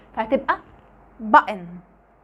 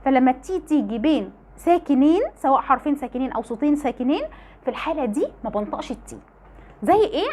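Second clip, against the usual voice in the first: ordinary talk, nothing marked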